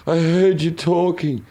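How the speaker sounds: a hint of a drunk stumbling out of the bushes; mild drunken voice; drunk friend. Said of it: "stumbles out of the bushes".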